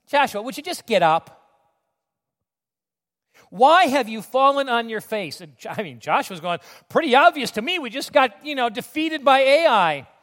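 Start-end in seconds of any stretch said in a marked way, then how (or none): 0.1-1.4 louder, raised fed-up scolding; exasperated parental tone. Acted. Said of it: "I think there was tone". 3.5-5.4 loud, slow, raised scolding; exasperated rebuke. Acted. God scolding Joshua, acted. 6.9-10.1 high, loud protest; defensive retort. Acted. Joshua answering back to God.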